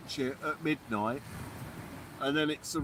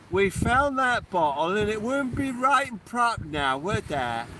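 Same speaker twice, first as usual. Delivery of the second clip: hammed-up West Country farmer, loud and bouncy; mock Somerset accent; 2 semitones higher, 4 dB louder than usual. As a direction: play a jolly Somerset yokel. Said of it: "Is that what they sound like in Somerset".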